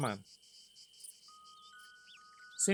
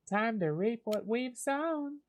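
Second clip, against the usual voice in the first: Luna's southern voice; 11 semitones higher, slower than usual. Acted. Voicing Luna's next-round line.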